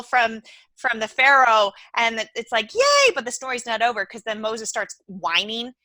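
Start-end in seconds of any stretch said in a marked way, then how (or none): 2.7-3.1 high, loud cheer of triumph; much higher, louder yay; mock cheer. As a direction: squeal a celebratory yay. The people celebrating rescue.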